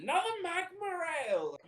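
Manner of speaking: very high, far-off hearty shout; boisterous bellow. Acted. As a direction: bellow it like a rowdy paladin. Rogar orders loudly again.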